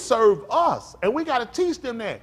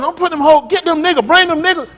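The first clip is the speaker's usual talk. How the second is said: loud, high retold shout; the rapper calling men up, shouted loud and high; shouted quote; 9 semitones higher, 9 dB louder than usual. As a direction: yell it like the rapper did on stage. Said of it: "He told them".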